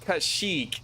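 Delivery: Kashyyyk with a long ee, ka-SHEEK; long-ee pronunciation. Shown as a demonstration. Unsure streamer to viewers, Kashyyyk said kuh-SHEEK. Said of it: "not knowing how to say".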